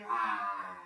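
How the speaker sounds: a low growling roar; lion roar. Pretend. Teacher roaring like a lion in lion pose. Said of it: "come up to roar like a lion".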